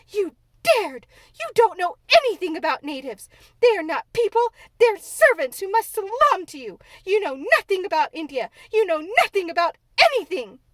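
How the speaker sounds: put-on humiliated girl ranting at the maid; raging tirade. A narrator's reading. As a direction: unload all the rage at once. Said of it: "control her rage and humiliation".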